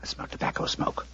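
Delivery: breathy whispered exchange. Acted. Holmes and Watson whispering while waiting in the dark. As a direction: whisper as if the killer is next door.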